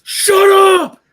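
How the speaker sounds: explosive high-pitched shout; yell. Retold or imitated. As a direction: bellow it at full volume to silence a screaming kid. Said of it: "I just yell at him".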